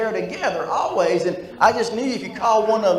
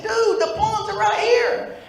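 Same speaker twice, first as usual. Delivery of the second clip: exasperated high call; slower than usual. Retold or imitated. Exasperated shout to customer.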